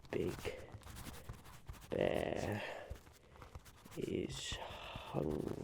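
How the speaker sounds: slow, soft words spoken while writing them down; murmured dictation. Acted. The Onceler noting Big Bear's idea, murmured slowly. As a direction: mumble each word as you jot it.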